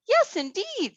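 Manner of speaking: put-on a tiny girl agreeing happily; little girl voice. Thumbelina answers the fish.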